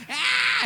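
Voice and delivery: a high squeal of pain, mock; old woman's shriek. Old lady shrieking at losing rock paper scissors, high squeal. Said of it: "and she's like".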